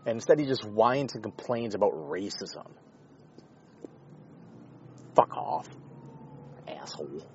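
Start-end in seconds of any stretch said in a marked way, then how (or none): 5.1-7.2 muttered insult. Real, not acted.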